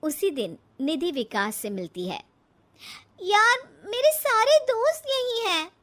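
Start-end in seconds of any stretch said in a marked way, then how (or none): 3.2-5.7 upset protest. Acted. Upset bride refuses village move.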